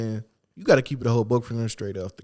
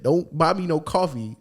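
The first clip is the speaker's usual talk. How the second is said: usual speaking voice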